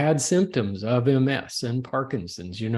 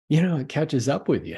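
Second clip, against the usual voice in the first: normal talking voice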